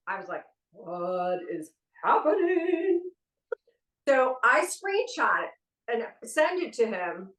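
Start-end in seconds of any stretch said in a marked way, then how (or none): 0.7-3.0 slow, drawn-out bewildered question; dazed and slow; slow bewilderment. Retold or imitated. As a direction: let the confusion stretch each word. Baffled at matching screenshots.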